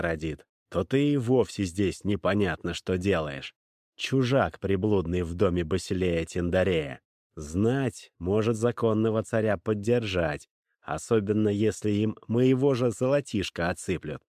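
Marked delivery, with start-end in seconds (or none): none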